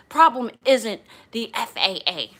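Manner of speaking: mock-angry passenger, loud; loud, insistent imitation of an arguer; angry retort. Passenger argues with flight attendant about rules, mildly angry retort. Retold passenger arguing back.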